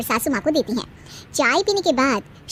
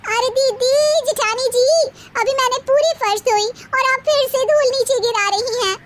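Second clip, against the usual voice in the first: put-on much higher, indignant girlish voice; youngest daughter-in-law; 9 semitones higher than usual. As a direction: insist on your rights, pitched way up. Youngest bahu scolding.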